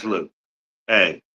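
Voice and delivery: usual speaking voice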